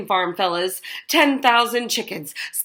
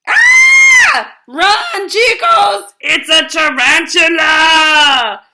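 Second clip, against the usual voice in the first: high, loud panicked shriek; terrified scream; 6 semitones higher, 9 dB louder, slower than usual. Acted. Mister Shark fleeing the tarantula, terrified screaming. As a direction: scream and flee the spider.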